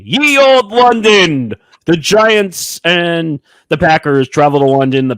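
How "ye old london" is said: mock olde English. Mock olde English London intro.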